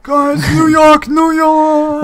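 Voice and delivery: high, loud mock hollering; mock livestream shout. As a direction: holler it as a hammed-up impression. Mock livestream caller shouting New York.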